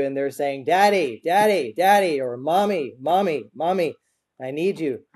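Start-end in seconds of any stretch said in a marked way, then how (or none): 0.6-2.2 mock child's insistent call, higher and louder; imitating a child calling. Preacher imitates child calling parent. 2.4-5.0 mock child whining for Mommy, higher pitched; a kid tugging at Mom, pitched up; imitating a child calling. Preacher imitates child calling parent.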